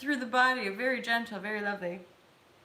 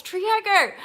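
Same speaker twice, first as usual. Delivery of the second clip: delighted call-out; 10 semitones higher, 10 dB louder than usual. Real, not acted. Calling out another viewer.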